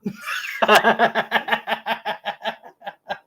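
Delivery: cracking up at the story; long laugh. Real, not acted.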